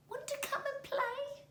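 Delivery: very high small voice; little kiwi voice. A narrator's reading. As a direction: ask eagerly like a tiny bird.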